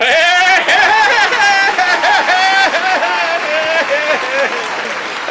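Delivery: long, high laughing fit at own joke; drawn-out high cackle; hammed-up laugh. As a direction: crack up far too long at your own pun. Laughs at his own pun.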